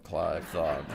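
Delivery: usual speaking voice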